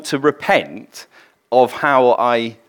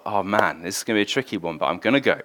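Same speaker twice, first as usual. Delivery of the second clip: softer, muttered to himself in dread; worried inner thought; 5 semitones lower, 7 dB quieter, faster than usual. Retold or imitated. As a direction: quietly think it, uneasy.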